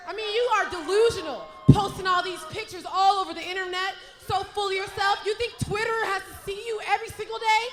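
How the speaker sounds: sassy heel promo, ending in a shout; mock her, then threaten; sassy trash talk.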